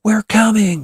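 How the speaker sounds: loud, high reassurance across distance; yelling. A narrator's reading. Boys answer Witch's scream, yelling. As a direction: holler that help is on its way. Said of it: "yell the boys".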